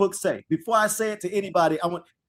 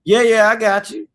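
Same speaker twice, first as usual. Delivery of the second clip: careless agreement; 4 semitones higher, 7 dB louder than usual. Retold or imitated. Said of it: "And Adam's like".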